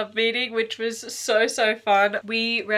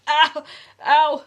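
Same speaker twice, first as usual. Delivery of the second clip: high, loud yelp of pain; real sudden cry of "ow"; 8 semitones higher, 9 dB louder than usual. Real, not acted. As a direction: yelp sharply as if stepped on. Pet treads on speaker painfully.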